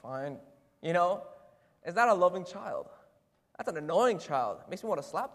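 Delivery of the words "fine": low, muttered mock consent; grudging response. Believer told to love a coworker, grudging. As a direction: imitate a sulky, reluctant yes. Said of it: "Is our response".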